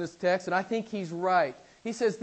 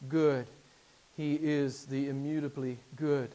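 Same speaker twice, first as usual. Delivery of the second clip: usual speaking voice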